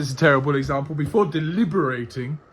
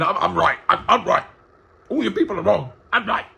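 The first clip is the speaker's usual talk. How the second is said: raised, insistent mock voice; mocking the guest; 5 semitones higher, 4 dB louder than usual. Host parodies the guest defending his answers, mocking. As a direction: mimic a stubborn know-it-all, pitched up. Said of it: "but you're saying".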